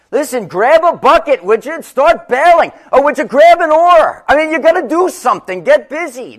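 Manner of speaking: loud, high, frantic urging; frantic disciples shouting in the storm; panicked plea. Acted. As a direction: beg him to do something, fast and loud. Disciples plead with sleeping Jesus.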